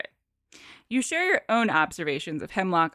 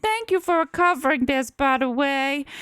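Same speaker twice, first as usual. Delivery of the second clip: put-on comic Southern accent; 4 semitones higher, 3 dB louder than usual. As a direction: play a Southern gentleman, pitched up. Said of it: "You're definitely the South".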